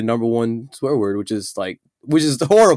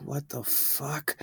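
stunned, hushed and slow; shocked; 2 semitones higher, 17 dB quieter, slower than usual. Retold or imitated. Showman reacting to something shocking, hushed disbelief. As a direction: barely breathe it out in disbelief. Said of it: "I'll see something that shocks me".

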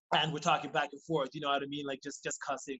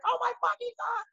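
mock scream, pitched far higher; hammed-up scream of pain; retold scream. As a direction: shriek it like he's hurt. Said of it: "started screaming and shouting".

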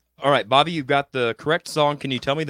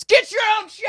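higher, louder mocking squeak; mock squeaky voice; 5 dB louder than usual. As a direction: squeak it out like a brat. Mock retort to commenter.

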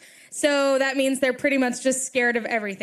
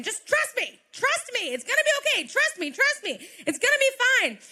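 frustrated pleading at the rabbit; 8 semitones higher than usual. Retold or imitated. Owner chasing her rabbit, pleading, frustrated and high. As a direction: beg the rabbit over and over, exasperated. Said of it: "I'm just yelling over and over again".

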